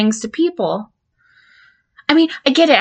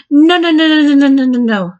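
panicked pleading; 3 semitones higher, 4 dB louder, faster than usual. Acted. Begging the counselor not to send the files, frantic. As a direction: beg him to stop, rapid and frantic.

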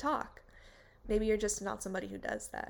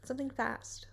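usual speaking voice

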